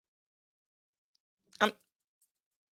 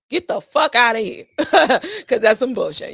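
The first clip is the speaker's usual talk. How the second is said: louder laughing dismissal; laughing scorn; 3 semitones higher, 7 dB louder, slower than usual. Real, not acted. Scoffing at the man's claim, laughing loud scorn. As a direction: laugh it off with contempt.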